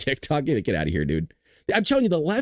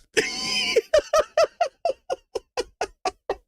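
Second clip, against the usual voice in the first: high wheezing laugh at his own joke; big real laugh. Real, not acted. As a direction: crack up at your own joke.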